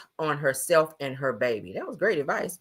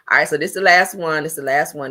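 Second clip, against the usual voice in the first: usual speaking voice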